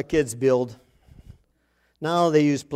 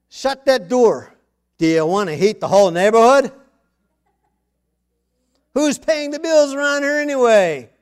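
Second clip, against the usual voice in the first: scolding dad; 7 semitones higher, 9 dB louder than usual. Retold or imitated. Father scolding kids over the heating bill, loud mock scolding. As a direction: bark it like a fed-up father. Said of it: "to say the darndest things".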